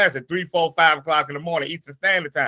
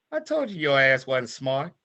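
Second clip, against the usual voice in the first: mama's voice; 3 semitones lower, 6 dB quieter than usual. Retold or imitated. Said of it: "my mama's voice".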